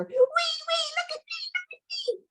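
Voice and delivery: very high whispery squeals; breathless squeal. Acted. Boy on the swing teasing his sister, high whispered squeals. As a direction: squeal it with no voice, giddy.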